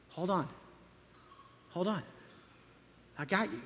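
very soft, hushed and gentle, almost breathed; still small voice. Acted. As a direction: soothe him quietly, like a voice in the heart.

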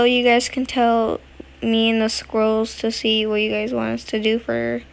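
ordinary talk, nothing marked

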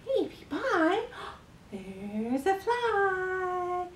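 drawn-out sing-song with an excited gasp; nursery rhyme performance. Shown as a demonstration. Carer trying a fly rhyme with baby, playful sing-song. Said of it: "let's try this one".

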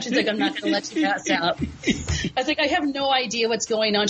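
real giggles breaking through the words; laughing while retelling. Real, not acted. Guest retelling her onstage panic to the host, laughing through it. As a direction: tell it through helpless laughter.

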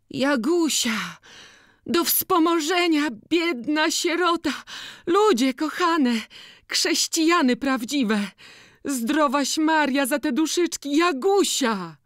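put-on high, loud, slow begging wail of an old beggar; beggar woman calling out. A narrator's reading. Old Agata begging at the monastery wall, calling out loudly. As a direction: chant for alms across the fair. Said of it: "kto ją wołał".

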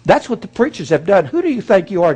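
ordinary talk

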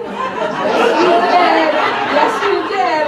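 very loud, insistent comeback; teasing accusation. Retold or imitated. Chef teases her embarrassed client, hollering.

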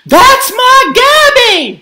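high, loud, drawn-out mother's cry; bellowing. A narrator's reading. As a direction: bellow it out slowly. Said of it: "she bellowed".

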